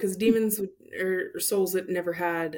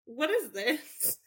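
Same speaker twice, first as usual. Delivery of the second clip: incredulous; 9 semitones higher than usual. Retold or imitated. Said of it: "And I'm like".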